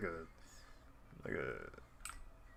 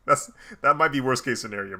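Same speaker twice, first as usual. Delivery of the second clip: normal talking voice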